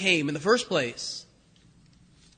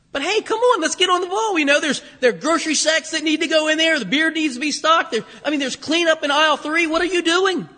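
higher-pitched boss chivvying a worker; mock scolding from a supervisor, pitched up; first boss; 6 semitones higher than usual. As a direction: nag an employee to get on with it. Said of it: "I remember my first boss".

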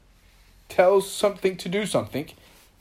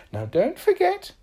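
high, louder warning; firm reminder; 14 semitones higher, 6 dB louder than usual. A narrator's reading. Little Miss Magic reminds Mister Tickle of the deal, high firm reminder. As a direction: remind with a raised voice. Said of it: "she reminded".

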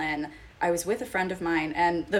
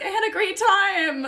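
very high, put-on sweetness with a laugh; mock cheerful; 13 semitones higher, 6 dB louder than usual. Host mimics women lying to dates, mock cheerful.

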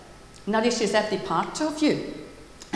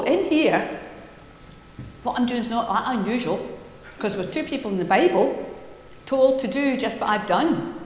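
ordinary talk

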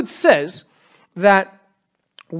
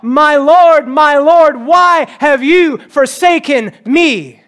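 loud anguished cry to God, drawn out; a slow, loud anguished plea; 5 semitones higher, 7 dB louder, much slower than usual. Retold or imitated. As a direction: cry out to the heavens in despair. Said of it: "Jesus cried out".

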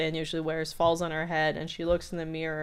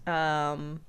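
ordinary talk

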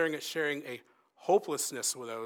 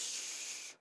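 long soft shh; acted parent's gentle shushing; soothing shush; 10 dB quieter than usual. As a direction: hush an upset child gently. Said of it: "that parent just leans over and goes".

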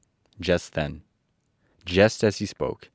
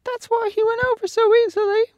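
very high, much louder, fast squeaky lion voice; a big beast marvelling; high squeaky lion remarking; cowardly lion; 9 dB louder, faster than usual. A narrator's reading. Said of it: "remarked the lion".

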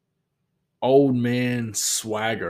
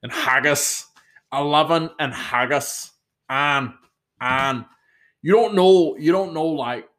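amateur Scottish, jumping higher on the later phrases; attempted Scottish accent; 3 semitones higher than usual. Shown as a demonstration. Vlogger building on his one word, attempted Scottish accent. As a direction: feel your way into a Scottish accent. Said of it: "the Scottish word that I can nail".

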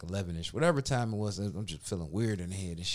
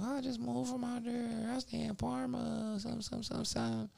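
imitating her; 13 semitones higher, 7 dB quieter than usual. Caller telling Mike where she lives, high soft imitation. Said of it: "She's like".

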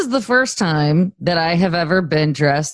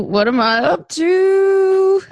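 much higher, stretched out very slowly and sing-song; playful sing-song; much slower than usual. Pretend. Host teasing co-host before announcing news, playful sing-song. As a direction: draw it out teasingly as if keeping a secret.

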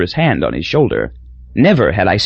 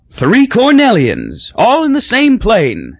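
higher and slower, savoring it; hearty delight; 11 semitones higher, slower than usual. A narrator's reading. Crosby cheering three Cornellians on one plane, high slow delight. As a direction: beam it out to the whole plane. Crosby celebrating fellow alumni.

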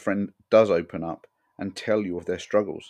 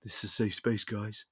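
softer, hushed mock therapy-circle voice; mock hushed tones; 2 semitones higher, 7 dB quieter than usual. Mocking men sat in a circle with hushed, soft tones. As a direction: gently parody a support-group whisper. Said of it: "talking in hushed tones".

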